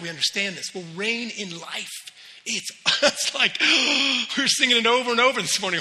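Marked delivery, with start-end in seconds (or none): 3.6-4.3 sharp, high intake of breath; awed gasp. Acted. Preacher showing the church his awe, gasping. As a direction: gasp loudly as if it just hit you. Acts out sudden awed realization.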